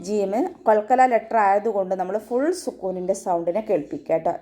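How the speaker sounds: ordinary talk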